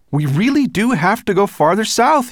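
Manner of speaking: high, loud, pressing; calling out. A narrator's reading. Ben pleading for the southern route, calling out. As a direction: insist at full volume. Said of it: "he called".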